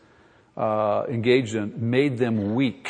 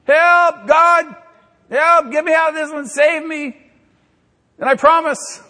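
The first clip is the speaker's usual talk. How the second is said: frantic high shouted plea for rescue; desperate prayer; 13 semitones higher, 7 dB louder than usual. Retold or imitated. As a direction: cry out to God in panic, much higher and louder. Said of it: "the foxhole prayer".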